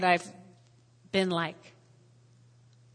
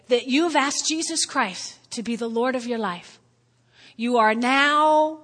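ordinary talk

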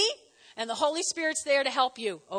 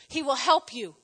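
ordinary talk

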